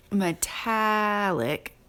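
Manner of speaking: metallic slow, stressed short a in tal. Shown as a demonstration. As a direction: metallic said slowly, lean on the short a. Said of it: "short sound".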